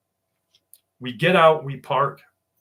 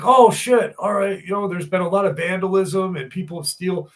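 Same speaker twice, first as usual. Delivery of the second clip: higher, louder "oh shit" that settles down; startled backpedal; 6 semitones higher, 5 dB louder than usual. Retold or imitated. Foreman backing down when told about the lost dog, startled. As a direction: suddenly caught off guard, then explaining. Foreman suddenly backs down, surprised.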